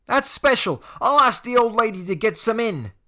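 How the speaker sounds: high, loud sarcastic sneer; jeering teen sidekick; mocking bully. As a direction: sneer in fake praise. Craig mocking.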